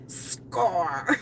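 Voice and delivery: low, imitated private victory; mock gloat kept to himself; secretly triumphant. Nephew after girl leaves, imitated secret triumph. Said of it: "So secretly deep down".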